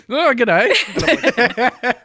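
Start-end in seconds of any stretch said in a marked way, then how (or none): 0.1-0.7 high, loud startled Australian hello; reflexive g'day. Retold or imitated. Caught off guard by a neighbour, broad Australian. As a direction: blurt it out in surprise. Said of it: "the reflexive response from my body was just".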